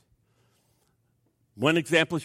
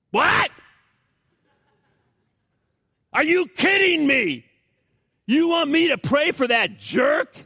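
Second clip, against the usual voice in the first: outraged shout; 10 semitones higher, 5 dB louder, slower than usual. Acted. Flesh protests loudly.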